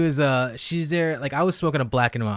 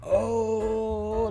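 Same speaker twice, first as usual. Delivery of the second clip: long, high, softer retold reaction; taken aback; 6 semitones higher, 7 dB quieter than usual. Man retelling his reaction to the girl's reveal, taken aback. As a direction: hold the vowel high and softly.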